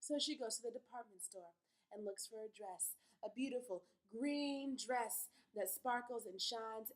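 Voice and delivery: ordinary talk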